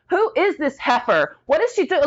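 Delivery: high-pitched retold cussing fit; freaking out. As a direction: act out a rant while freaking out. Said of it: "like, I'm freaking out".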